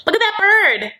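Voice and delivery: very high mock distraction; imitating Brittany. Girlfriend distracting suitor from talk of converting, high mock excitement. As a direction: divert him with a sudden high squeal. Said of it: "I'm always just like".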